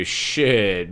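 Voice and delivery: ordinary talk, nothing marked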